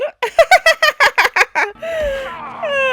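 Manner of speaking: loud, high-pitched burst of laughter; long helpless laugh; big real laugh. Real, not acted. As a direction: crack up loudly at something silly. Laughing at the troll's impression.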